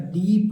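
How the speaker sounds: "डीप" with a held ee; long ee vowel. Shown as a demonstration. Teacher modelling double-e for children, long-vowel "डीप". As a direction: draw out the ee in "डीप". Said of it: "बड़ी की मात्रा लगा देंगे".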